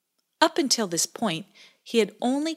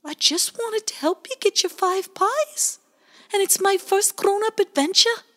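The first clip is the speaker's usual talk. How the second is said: tiny voice; 14 semitones higher, 5 dB louder than usual. A narrator's reading. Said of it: "whispered Bowie in a tiny voice".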